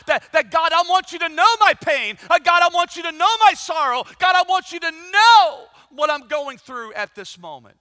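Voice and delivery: loud, high, impassioned plea; crying out to God. Acted. Believer pouring out pain to God, loud pleading cry. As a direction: hurl the prayer upward at full preaching force.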